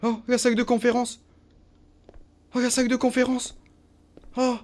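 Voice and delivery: loud, high cry of discovery; excited. Real, not acted. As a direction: shout with sudden relief. Trouve enfin la salle. (s'écrier avec joie en trouvant la salle)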